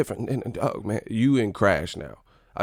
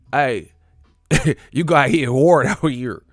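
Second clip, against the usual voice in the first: loud, hammed-up Cali accent; 3 semitones higher, 7 dB louder than usual. Shown as a demonstration. Riffing on Cali slang, loud Cali accent. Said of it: "that's how we say in Cali".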